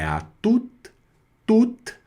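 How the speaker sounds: "tout" said with a pure U vowel. Shown as a demonstration. Teacher explains the vowel pair, "tout" pronounced with U. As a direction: sound "tout" as a short syllable with U. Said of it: "o O mais U vira U".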